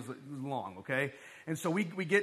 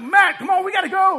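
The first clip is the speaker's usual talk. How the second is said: much higher, much louder urgent yell; frantic shout; 13 semitones higher, 14 dB louder than usual. Retold or imitated. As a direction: yell at a friend to hurry up. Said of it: "I'm like".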